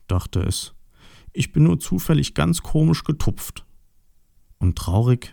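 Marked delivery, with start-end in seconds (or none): 1.3-3.6 higher-pitched, mildly downcast piglet; lightly acted dejection, pitched up; sad piglet. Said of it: "wurde ganz traurig".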